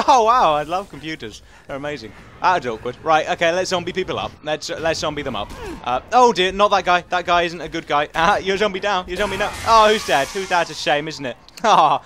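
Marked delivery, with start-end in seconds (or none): none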